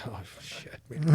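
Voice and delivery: whispered under the breath, almost no voice; whispered dread. Retold or imitated. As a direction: mutter it to yourself so nobody hears. Rookie's private dread, under breath.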